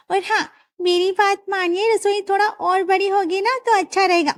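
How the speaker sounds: high, sweet daughter-in-law asking a favour; young daughter-in-law. Acted. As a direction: coax the father-in-law for a bigger kitchen.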